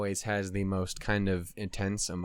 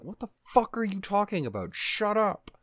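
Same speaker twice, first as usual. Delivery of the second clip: mock irritated snap; 9 semitones higher than usual. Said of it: "just be like".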